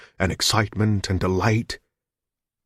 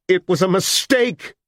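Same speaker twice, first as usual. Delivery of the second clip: much higher, slow, louder insistence; insistent shout; 12 semitones higher, 4 dB louder than usual. A narrator's reading. Hostage shouts her denial back at Richards. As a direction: insist hard.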